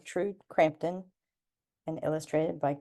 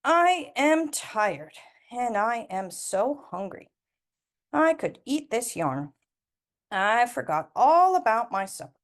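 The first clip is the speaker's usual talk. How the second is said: slow, weary start, then brighter; tired old woman; 6 semitones higher, 3 dB louder than usual. Acted. Granny Glittens telling her cat she is tired, slow weary. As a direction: sigh it out to the cat. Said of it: "she said to the cat".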